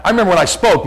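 ordinary talk

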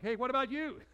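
loud, high holler to a stranger; hammed-up eager shout across the room; retold calling out. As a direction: call out to grab someone's attention. Retells calling out to another worker.

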